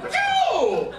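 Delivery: very high, loud laser zap; laser sound effect. Retold or imitated. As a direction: shriek a falling news-sting "pew". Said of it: "like, the laser sound effects".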